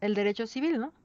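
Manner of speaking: ordinary talk, nothing marked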